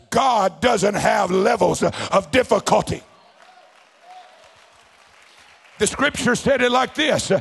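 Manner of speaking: loud, driving revival preacher's delivery; full-force preaching. Real, not acted. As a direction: preach it out hard and high to the congregation.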